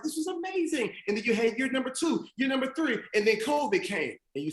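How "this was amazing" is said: much higher, softer, starry-eyed mock voice; mock gushing. Speaker imitating first-year teachers, gushing in a mock high voice. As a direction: play the wide-eyed rookie teacher.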